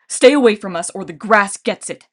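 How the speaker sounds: loud threatening warning. Acted. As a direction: warn them off loudly. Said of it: "he warned".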